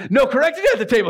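high-pitched, hammed-up comeback; mock squabbling retort. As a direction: escalate the childish argument. Siblings' escalating quarrel.

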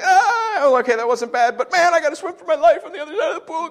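high, fast relieved-then-panicked voice; mock panic. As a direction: rush it out like you're about to swim for your life. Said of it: "and I'm like".

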